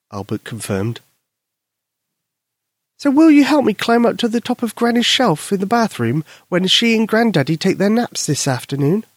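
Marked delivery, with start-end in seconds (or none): 3.0-9.1 put-on squeaky high child voice; a little girl owning up. Susie admitting to her friend, high girl voice.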